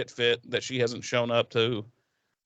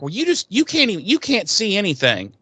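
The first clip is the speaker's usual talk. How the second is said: high, exasperated mock retort; imitating a flustered comeback; retold arguing; 7 semitones higher than usual. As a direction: argue back in a raised pitch. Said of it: "they're arguing back and forth".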